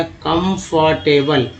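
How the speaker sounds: कंफर्टेबल very slowly, in separate parts; syllable-by-syllable demo. Shown as a demonstration. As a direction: sound out कंफर्टेबल piece by piece. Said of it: "यहाँ पर एबल है तो टी लगा". (कंफर्टेबल को बहुत धीरे तोड़कर बोलें)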